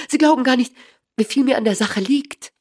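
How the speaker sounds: high, loud, eager outburst; excited cry. A narrator's reading. Small man telling Holmes how much this matters, crying out eagerly. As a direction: pour it out with urgency. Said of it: "rief der kleine Bursche".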